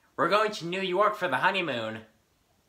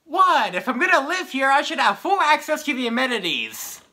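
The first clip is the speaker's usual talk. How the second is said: indignant protest; 6 semitones higher, 6 dB louder than usual. Acted. Indignant protest after being scolded.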